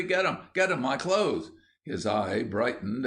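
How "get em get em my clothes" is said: high, urgent exclamations; excited. A narrator's reading. William urges Ginger to grab the suit, excited. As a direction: egg him on eagerly. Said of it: "said William excitedly".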